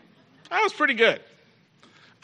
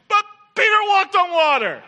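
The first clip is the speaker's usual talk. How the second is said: much higher, loud preaching shout; shouted proclamation; 11 semitones higher, 5 dB louder than usual. Acted. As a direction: shout the wonder out.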